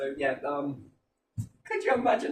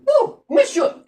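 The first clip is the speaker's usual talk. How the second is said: put-on very high, loud poodle with French accent; 9 dB louder, slower than usual. French poodle in an imagined cartoon, high loud French voice. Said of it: "And then the French poodle".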